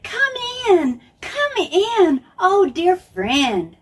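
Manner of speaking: sing-song storybook welcome; welcoming sisters. Acted. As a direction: greet a dear guest at the door, delighted. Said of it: "opened the door and said".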